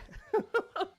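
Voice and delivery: sudden high-pitched laugh; short squeaky laugh; high burst of laughter. Real, not acted. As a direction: crack up at the absurdity. Laughing at the comparison.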